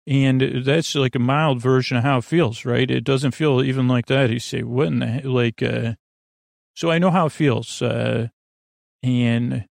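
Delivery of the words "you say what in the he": ordinary talk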